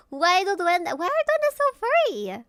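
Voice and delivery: high indignant squeal; incredulous squeal. Real, not acted. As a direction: squeal the question in disbelief. Exasperated question about furry.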